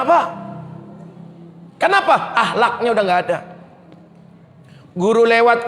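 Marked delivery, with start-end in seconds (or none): none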